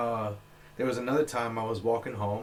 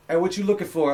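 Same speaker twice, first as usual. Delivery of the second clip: raised, higher mock confrontation; retold challenge; 8 semitones higher, 11 dB louder, faster than usual. Stranger challenging a passerby on the sidewalk, mock confrontation.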